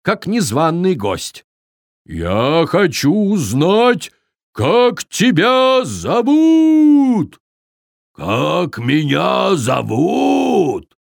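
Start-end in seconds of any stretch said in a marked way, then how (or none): 2.0-7.4 loud, slow bold yell; barking shout. A narrator's reading. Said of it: "рявкнул почти так же громко". 8.1-11.0 slow puzzled giant. A narrator's reading. Giant confused.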